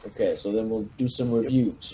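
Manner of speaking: usual speaking voice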